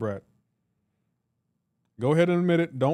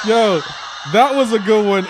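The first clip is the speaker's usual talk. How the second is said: much louder, delighted; loud amused exclamation; 5 semitones higher, 8 dB louder than usual. Real, not acted. Streamer cracking up at chat's joke, loud delighted praise. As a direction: call it out in delight.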